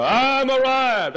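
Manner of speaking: high, drawn-out, self-important boast; mock pompous. Preacher mocking a seminary graduate's smug, slow boast. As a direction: lampoon the puffed-up graduate, slowly. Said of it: "a fellow goes off to go".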